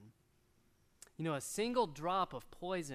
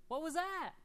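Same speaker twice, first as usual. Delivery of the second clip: mock surprise; 9 semitones higher than usual. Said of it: "It's like".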